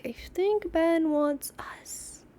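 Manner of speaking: slow scheming whisper; conspiratorial whisper. Retold or imitated. Scheming to family about the neighbor's cat, whispered. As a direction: plot it under your breath. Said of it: "To me, I'm like".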